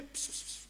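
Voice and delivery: soft unvoiced ps ps ps; quietly psst for a cat; cat-calling psst. Shown as a demonstration. Explaining how to call a cat, soft unvoiced psst. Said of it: "zeg je".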